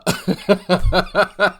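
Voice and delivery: high, loud burst of laughter; real laugh. Real, not acted. Streamer amused by the video, real laughing out loud. Amused by his own reference.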